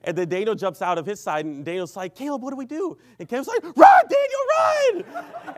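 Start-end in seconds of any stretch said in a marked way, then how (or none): 2.2-3.0 high-pitched, helpless mock panic; panicked question. Friend jumps out and asks what to do, panicked question. 3.6-5.6 very high, drawn-out yell; panicked shout. Retold or imitated. Friend tells companion to flee the car, panicked shout.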